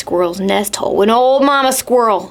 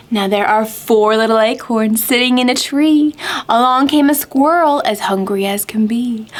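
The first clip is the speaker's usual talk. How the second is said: sing-song rhyme with gasping wind effects; counting rhyme for kids; 4 semitones higher than usual. Acted. Teacher reading a counting rhyme to children, sing-song. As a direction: read it like a children's counting game.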